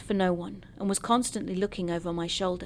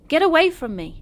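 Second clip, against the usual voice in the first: high, loud narrated scream; 9 semitones higher, 8 dB louder than usual. A narrator's reading. Walker leaping back from a backhand, screaming. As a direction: cry out, pitch and volume leaping up.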